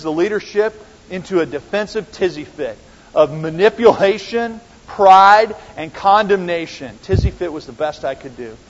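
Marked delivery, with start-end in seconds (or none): none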